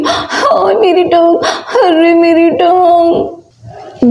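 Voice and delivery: slow, drawn-out comic wail; drawn-out moan. Acted. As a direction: stretch the moan out slowly. Mother moaning about leg. (धीमी, खींची हुई कराह)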